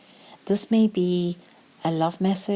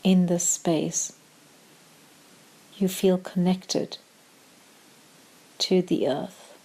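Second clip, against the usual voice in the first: calm meditation voice with long pauses; short, measured phrases, leaving room to breathe; guided meditation; slower than usual. Acted. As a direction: guide them gently into stillness, unhurried.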